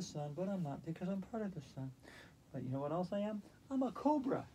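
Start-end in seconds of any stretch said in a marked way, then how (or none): 3.7-4.5 proud cobra. Pretend. Declares herself a cobra.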